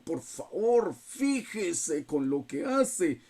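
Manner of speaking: slow, cold and cutting reproach; imitating a father's threatening tone with his son; stern warning tone. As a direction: una advertencia fría y lenta. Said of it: "y con el tono".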